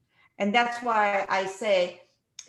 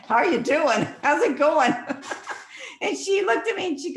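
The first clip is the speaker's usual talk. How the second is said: retold question, giggling, high and louder; laughing through it; 9 semitones higher, 3 dB louder than usual. Telling the parking-lot story, imitating her own laughing question. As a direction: ask it while breaking into laughter. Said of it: "I'm like".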